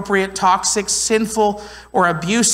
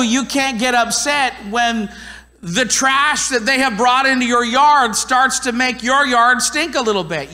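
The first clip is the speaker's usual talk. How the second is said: usual speaking voice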